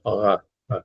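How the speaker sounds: "Uh" led by an R at the back of the throat; short guttural French R before "uh"; French-style throaty R. Shown as a demonstration. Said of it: "French pronounce the R back towards".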